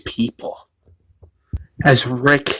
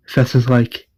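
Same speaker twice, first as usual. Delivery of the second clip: ordinary talk, nothing marked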